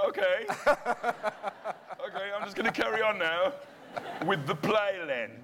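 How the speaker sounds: co-star teasing him mid-scene, laughing; imitating a co-star, laughing. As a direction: imitate a co-star carrying on, amused. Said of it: "Lucy Musumati looked at me and went".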